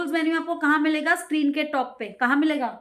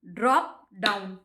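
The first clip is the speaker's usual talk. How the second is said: Drop down said slow and lower, distracted; teacher's drill; drilling a term; 6 semitones lower, slower than usual. Shown as a demonstration. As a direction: repeat the term while searching. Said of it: "अरे, pencil कहाँ गई?".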